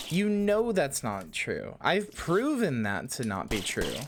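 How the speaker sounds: high-pitched, emphatic indignation; insulted protest. Real, not acted. Streamer refuting Hannah's self-deprecation, indignant.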